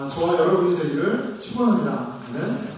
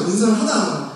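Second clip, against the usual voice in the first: ordinary delivery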